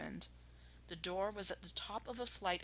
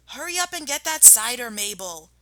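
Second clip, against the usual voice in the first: higher call from another room; calling woman; 6 semitones higher than usual. Acted. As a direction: call out to the servant.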